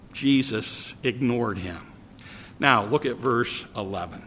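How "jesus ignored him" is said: ordinary talk, nothing marked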